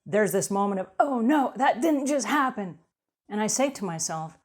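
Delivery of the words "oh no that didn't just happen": mock dismay. Dropping something valuable, a mock cry of dismay. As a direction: gasp at the breaking thing.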